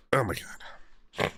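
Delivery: breathy, drawn-out whisper of disbelief; slow unvoiced groan of despair; exasperated whisper. Real, not acted. Groaning at the lawyer.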